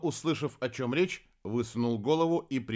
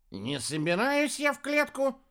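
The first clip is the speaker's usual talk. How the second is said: high-voiced, firm refusal; flat refusal. A narrator's reading. Хелений refuses to get into the cage, flat refusal. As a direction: cut the offer off in a high voice. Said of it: "отрезал Хелений".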